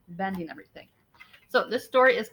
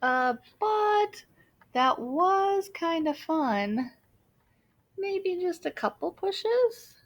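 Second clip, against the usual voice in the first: higher, slow sly temptation; tempted; 8 semitones higher, much slower than usual. Acted. Larry wants more pushes, higher, slow and sly. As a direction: tease them toward one more push.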